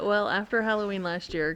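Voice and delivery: usual speaking voice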